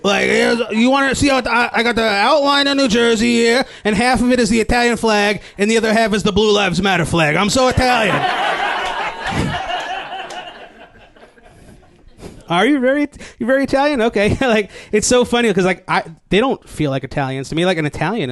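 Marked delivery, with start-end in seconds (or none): 0.0-8.2 high, hammed-up Jersey boasting; New Jersey Italian American. Jersey guy showing off his tattoo, hammed-up pride. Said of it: "People speak like that". 12.5-14.0 high-pitched teasing question; mock questioning. Comic questioning a very Italian guy, mock-impressed.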